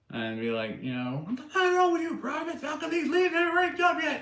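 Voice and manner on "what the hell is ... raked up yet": very high-pitched mock drill sergeant yell; imitated sergeant's high, strained holler. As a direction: chew out the privates like a sergeant. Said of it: "he would go and yell at them".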